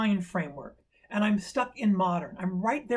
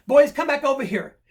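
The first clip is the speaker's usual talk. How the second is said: loud, high call across the street; yelling; 6 semitones higher, 8 dB louder than usual. Retold or imitated. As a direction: holler to bring the kids back. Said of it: "I start yelling".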